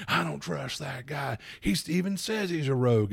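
mock-nervous villager griping about the rogue; wary townsperson. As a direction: play a jittery villager who distrusts him. Townsfolk voicing distrust of rogue.

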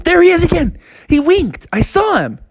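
put-on high, eager boy voice; excited high kid voice. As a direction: insist like a thrilled kid. Froggy excited.

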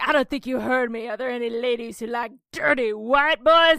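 a hint of a showy frontman; lightly hammed-up stage banter; mock rock singer. Frontman teasing the crowd, lightly mocking.

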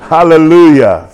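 normal talking voice

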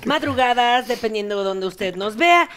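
drawn-out host greeting; big show-host opening; TV host intro. Acted.

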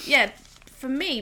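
usual speaking voice

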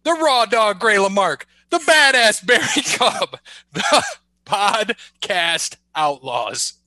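loud, high hype announcer; ringmaster. Pretend. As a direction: boom it out like a ring announcer. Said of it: "man, you're corny".

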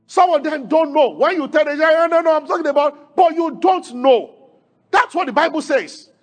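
loud, high, fervent preaching; preacher at full force; rising preaching shout. Real, not acted. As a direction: build to a full-voiced climax.

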